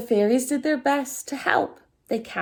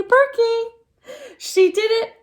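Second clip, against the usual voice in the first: high and loud, jubilant; proud excited exclaim; triumphant cheer; 11 semitones higher, 5 dB louder, slower than usual. A narrator's reading. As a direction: celebrate the win. Triumph over wilting tree.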